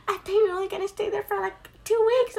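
high, dismayed disbelief; imitating a friend's shocked whine; mock outrage at the news; shocked protest. Retelling friend's shocked complaint.

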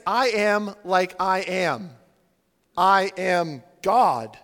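"I am God" very slow, each word weighted; firm, deliberate emphasis on "I am"; clear declaration. Acted. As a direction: spell out "I am God" with a pause before "God". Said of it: "the clarity of what he's saying".

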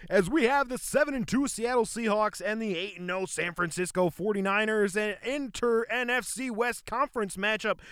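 play-by-play broadcaster voice; very professional announcer. Pretend. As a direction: welcome the fans like a real broadcast. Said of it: "like, very professional".

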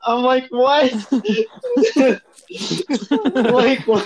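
high, laughing "what?"; giggling in disbelief. Real, not acted. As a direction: crack up at how dumb it was.

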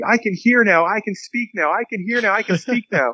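imitated high-pitched excited proclaiming; high, giddy repeated announcement, not shouted; excited announcing. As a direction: act out someone running round telling everybody. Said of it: "running around telling everybody".

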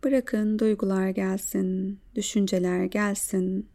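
calm guided breathing voice. Acted.

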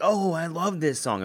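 lightly imitating a friend who just recognized the song; delighted friend. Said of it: "and they're like".

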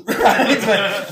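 ordinary talk, nothing marked

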